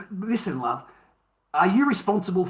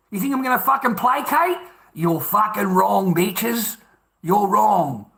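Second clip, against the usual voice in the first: heated, raised-pitch defiance; defiant anger; 3 semitones higher than usual. Real, not acted. Telling critics he won't beg or placate anyone, angrily defiant. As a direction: stand your ground angrily, hammering the key words.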